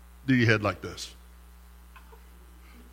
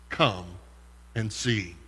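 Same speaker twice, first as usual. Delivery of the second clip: soft invitation; 8 semitones lower, 11 dB quieter, slower than usual. Retold or imitated. The simple invitation, softly.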